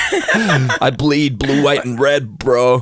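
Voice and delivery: slow mock American bro; light American bro voice; American bro accent. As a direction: stretch it out like a patriotic bro. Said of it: "What do Americans say?".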